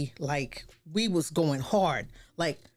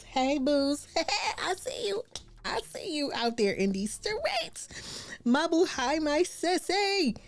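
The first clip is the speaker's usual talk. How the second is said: high, bright, slowed calls to friends; high squeal greeting; 11 semitones higher, slower than usual. Real, not acted. Host greeting her friends in the live chat, high squeal. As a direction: squeal hello to each friend watching.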